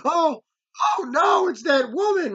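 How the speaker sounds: high-pitched mock alarm; imitated panicked priest. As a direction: mimic someone horrified by who just appeared, voice shooting up. Priest sees the dreaded woman.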